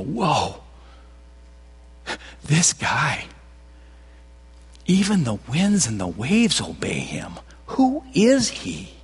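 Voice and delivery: terrified whisper between the disciples; awed whisper. Retold or imitated. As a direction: breathe it out in stunned fear. Disciples stunned after the storm stills.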